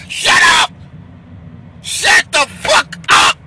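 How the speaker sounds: loud, high, enraged yelling; furious shout. Acted. Man fed up with his woman, screaming at her to shut up.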